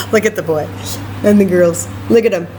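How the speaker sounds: ordinary delivery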